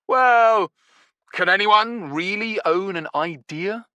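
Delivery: high, loud, airy brush-off. Acted. Stinky to Nevada, airily brushing off the theft. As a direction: shrug it off lightly.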